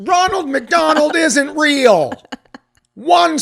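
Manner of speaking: high, slow shouted outburst; mock shout. Mock outburst in fake review.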